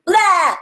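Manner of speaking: loud high "blah" yell; loud wordless-style outburst; feeling as sound. Shown as a demonstration. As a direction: blurt a silly high bleat. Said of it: "make a sound about how you feel".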